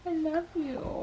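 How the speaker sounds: hushed and gentle, almost breathed; soft tender whisper. Real, not acted.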